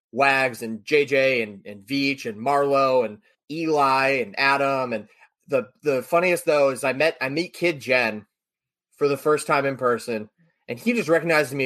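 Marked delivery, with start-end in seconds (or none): none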